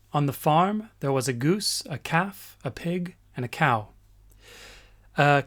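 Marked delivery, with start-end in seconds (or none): none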